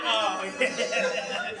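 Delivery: soft, low, laughing "oh yeah"; mock confident, under the breath; sly cocky murmur. A guy winding up to climax, softly cocky. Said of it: "Just like".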